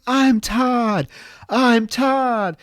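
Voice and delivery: high, drawn-out mock wail; mock freak-out. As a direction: wail it like someone losing it, hammed-up. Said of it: "he freaks out".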